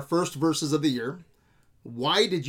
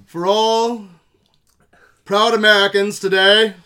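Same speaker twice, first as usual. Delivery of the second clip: slow, louder mock-archaic proclamation; old-timey voice; 2 semitones higher, 8 dB louder, slower than usual. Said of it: "[name]'s got one".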